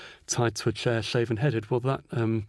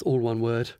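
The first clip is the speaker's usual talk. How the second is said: ordinary delivery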